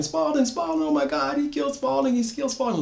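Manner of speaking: woman screaming as her friend is killed; screaming in horror. A narrator's reading. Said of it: "Sarah screaming".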